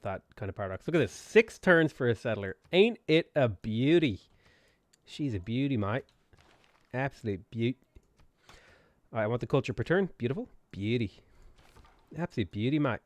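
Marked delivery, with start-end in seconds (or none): 2.7-7.7 drawn-out Australian "beauty, mate"; Australian accent. Pretend. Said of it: "this Aus-, Austra-, Australian accent". 10.2-13.0 playful Australian lilt; Australian accent. Pretend. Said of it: "this Aus-, Austra-, Australian accent".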